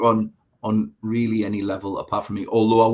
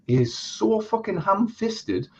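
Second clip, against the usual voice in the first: slow, drawn-out, higher-pitched disgust; exasperated emphasis; 9 semitones higher, much slower than usual. Real, not acted. Reviewer venting to his co-host about a bad film, slow exasperated emphasis. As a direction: drag out every word to hammer home the verdict.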